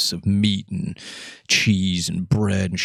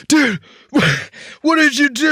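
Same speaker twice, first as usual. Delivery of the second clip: distressed friend; 3 dB louder than usual. Retold or imitated. Friend choking on onion, voiced.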